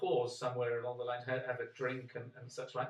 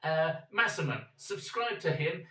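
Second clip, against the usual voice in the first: loud, punchy sign-off plug; rally viewers to subscribe; loud outro; 2 semitones higher, 7 dB louder than usual. Real, not acted.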